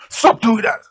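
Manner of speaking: loud, high shouted command; sharp shouted order. A narrator's reading. Gunman to those tending the wounded, shouted order. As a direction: shout it as an armed threat.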